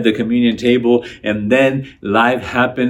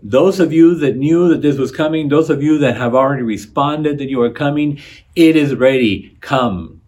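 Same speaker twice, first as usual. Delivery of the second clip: a touch louder, higher servant announcing the feast; servant's call; 4 semitones higher, 6 dB louder than usual. Retold or imitated. Servant calls the invited guests, a touch louder and higher. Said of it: "the servant saying".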